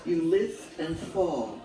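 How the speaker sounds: firm, measured verse recital in falling phrases; poetry read out to an audience; poem reading. Acted. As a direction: recite it line by line with pauses between. Reads homage poem aloud.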